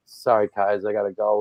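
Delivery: lightly voiced apologetic kid; sheepish eleven-year-old. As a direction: mumble it like a kid called away. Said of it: "like he's eleven years old".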